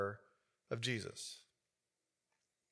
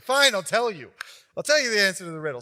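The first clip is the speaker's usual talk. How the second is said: louder, higher, fast fed-up surrender; exasperated giving in; 4 semitones higher, 6 dB louder, faster than usual. Retold or imitated. Samson finally caving to his crying fiance, exasperated. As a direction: throw up your hands and give in.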